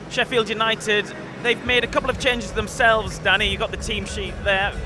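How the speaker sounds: ordinary talk, nothing marked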